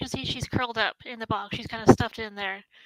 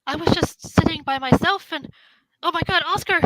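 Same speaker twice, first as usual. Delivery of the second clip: flustered, higher and louder, halting starts; bewildered young woman; 6 semitones higher, 7 dB louder than usual. Acted. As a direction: blurt it out in startled confusion. Plays bewildered woman from box.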